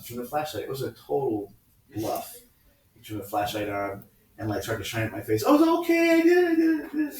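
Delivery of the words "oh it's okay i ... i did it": put-on very high, loud kid squeal; hammed-up terrified confessing; panicked confession. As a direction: blurt it out frantically like a caught child. Voices self confessing under flashlight.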